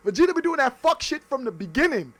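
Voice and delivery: ordinary talk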